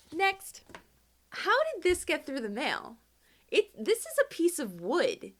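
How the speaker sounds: high, loud amazed exclamation; amazed high voice at a wooden postcard; surprised delight. Real, not acted. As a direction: gasp at the wooden card.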